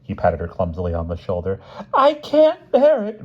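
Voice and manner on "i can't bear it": high, loud, drawn-out wail; sobbing. A narrator's reading. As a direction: cry out in high-pitched despair. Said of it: "Missus Smith sobbed".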